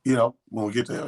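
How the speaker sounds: usual speaking voice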